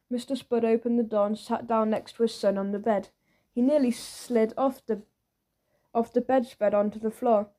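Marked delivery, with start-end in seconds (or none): none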